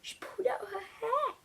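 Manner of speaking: high hushed whisper. Real, not acted. Reader whispering shocked recap to viewers.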